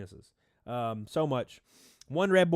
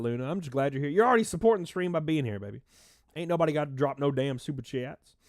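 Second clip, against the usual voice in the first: normal talking voice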